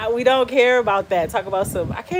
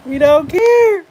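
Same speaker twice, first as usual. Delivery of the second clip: high, loud wail of laughter; crying laughing; 5 semitones higher, 6 dB louder, slower than usual. Real, not acted. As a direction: wail it slowly through tears of laughter. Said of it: "I'm literally crying".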